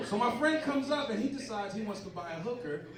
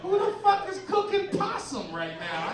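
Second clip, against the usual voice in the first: disgusted yell; 14 semitones higher, 9 dB louder than usual. Retold or imitated. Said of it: "you're like".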